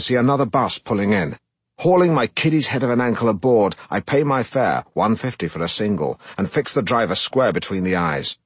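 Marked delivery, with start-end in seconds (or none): none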